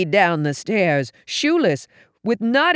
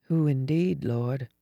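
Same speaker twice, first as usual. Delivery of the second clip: soft, low, sleepy musing; drowsy murmured prayer; drowsy thought; 4 semitones lower, 7 dB quieter than usual. A narrator's reading. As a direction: think it aloud softly, half asleep. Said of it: "I thought drowsily".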